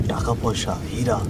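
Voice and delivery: ordinary delivery, nothing marked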